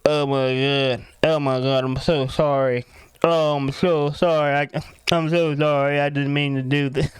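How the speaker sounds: mock pitiful drunk voice; imitating drunk apology. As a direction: play yourself wasted, apologising into the pillow. Drunk on pillow, apologising to wife.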